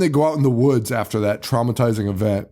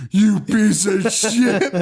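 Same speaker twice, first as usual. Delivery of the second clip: laughing insult; 8 semitones higher, much slower than usual. Retold or imitated. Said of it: "Killdozer's like".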